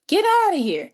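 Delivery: high, exclaimed disbelief; delighted disbelief. Retold or imitated. [name] hearing her play his solos, astonished.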